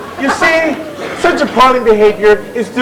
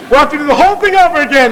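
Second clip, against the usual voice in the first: fast exasperated; 4 semitones higher, much faster than usual. Acted. Chairman scolds audience.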